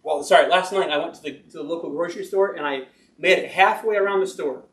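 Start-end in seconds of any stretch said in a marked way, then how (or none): none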